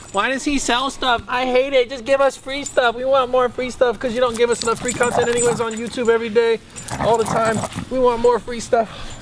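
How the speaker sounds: mocking haters. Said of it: "you guys wanna be haters and be like".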